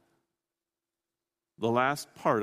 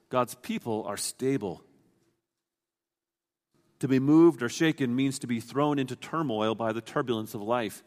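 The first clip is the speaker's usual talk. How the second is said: ordinary talk, nothing marked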